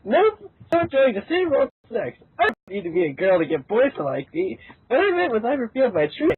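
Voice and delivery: ordinary delivery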